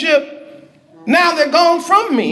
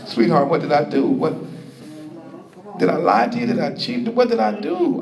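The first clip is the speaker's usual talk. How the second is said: low, subdued pleading with a partner; hurt husband; 8 semitones lower, 5 dB quieter, faster than usual. Acted. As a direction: question your sweetheart quietly, wounded. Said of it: "Some husband said".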